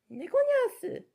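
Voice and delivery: very high kitty voice saying "Neko nyasu"; cat voice. Shown as a demonstration. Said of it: "I'll just be like this".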